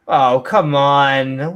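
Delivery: ordinary delivery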